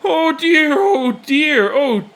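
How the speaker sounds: high, loud, overcome with wonder; an old man's high astonished cry; overwhelmed cry. Acted. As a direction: cry out in amazed delight. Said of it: "Pops cries".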